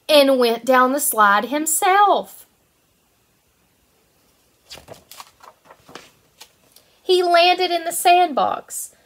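bright, lively storybook reading; reading to children. Acted. Teacher reading a picture book to her kindergarten class, lively storytelling. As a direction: read it out like it's circle time.

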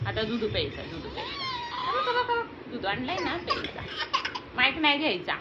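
high sing-song coaxing. Real, not acted. Coaxing baby from the mic.

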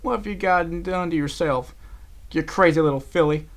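high-pitched, fond scolding; anguished concern. A narrator's reading. Applejack wiping her unconscious friend's face, anguished. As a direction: lament over a friend's foolishness.

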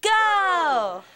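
cheer call. Real, not acted. Leading a crowd cheer.

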